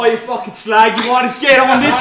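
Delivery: loud mock British street shout; rough British accent. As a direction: pick a fight like a British chav. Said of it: "But yeah, everybody else".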